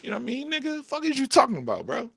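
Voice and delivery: very high, incredulous squeak; mock indignation. Vlogger scoffing at doubters, high-pitched mock indignation. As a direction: end the rant in squeaky disbelief. Acted retort to doubters about beans.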